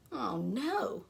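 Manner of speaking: ordinary delivery, nothing marked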